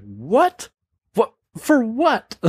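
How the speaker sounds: baffled questions leaping up high at the end; incredulous. Retold or imitated. Teens hearing the cop wants backup, incredulous. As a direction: pitch shoots up in disbelief.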